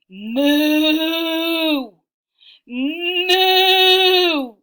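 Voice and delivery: loud, long, held moo; cow mooing. Acted. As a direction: bellow it out like a cow. Animal noises in read story.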